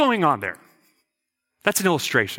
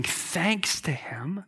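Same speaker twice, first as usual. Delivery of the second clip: hushed whisper; 8 dB quieter, much slower than usual. Acted. Whispered emphasis in sermon.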